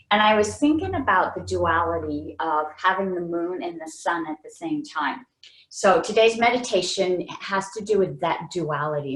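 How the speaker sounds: ordinary delivery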